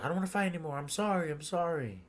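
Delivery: high, whimpering apology; mock pleading surrender. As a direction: beg off in a high voice. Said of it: "the Admiral gives up".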